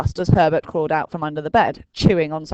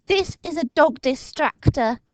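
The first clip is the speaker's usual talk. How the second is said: put-on higher, proud inventor boy; Dinking explaining; 10 semitones higher than usual. A narrator's reading. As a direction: present the gadget proudly. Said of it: "he told the frightening things".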